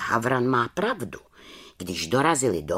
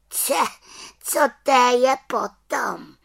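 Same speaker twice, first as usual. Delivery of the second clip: little witch; 13 semitones higher, 4 dB louder than usual. Acted. Witch voiced in story.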